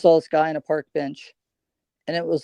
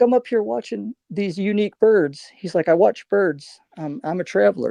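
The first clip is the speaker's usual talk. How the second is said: higher, lightly voiced traveler telling his story; a hint of the old bird watcher; bird-watching traveler; 6 semitones higher than usual.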